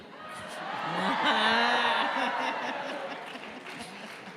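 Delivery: hearty real laugh; big laugh. Real, not acted. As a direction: laugh out freely at the exchange. Audience exchange laughter.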